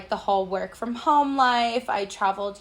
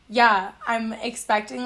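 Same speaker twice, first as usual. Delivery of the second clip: normal talking voice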